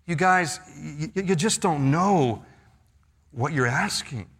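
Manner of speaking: a hint of a sad sigh; sighing, weary. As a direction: let the words sigh out, tired of them. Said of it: "with a sigh in his voice".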